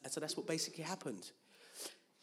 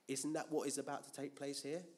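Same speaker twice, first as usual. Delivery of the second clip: ordinary talk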